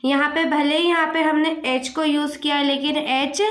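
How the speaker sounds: ordinary talk, nothing marked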